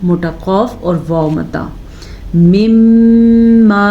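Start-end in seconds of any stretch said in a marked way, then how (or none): 2.4-3.9 मिम्मा with the मीम held in the nose; nasal ghunna. Shown as a demonstration. Teacher demonstrates the ghunna on मिम्मा, held nasal म. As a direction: hum the doubled म of मिम्मा. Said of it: "मीम का गुन्ना".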